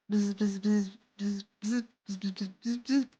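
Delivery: playful quiet bee buzz; mock bee buzzing. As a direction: buzz at them like a bee, then stop. Bee character joking buzz.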